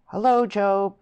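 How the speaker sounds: hearty shout of hello; shouted greeting. A narrator's reading. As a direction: call out to a friend across the moor. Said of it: "shouted Stephen".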